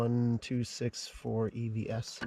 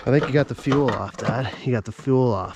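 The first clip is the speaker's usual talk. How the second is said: calling out; 9 dB louder than usual. Real, not acted. Calling to Dad over generator.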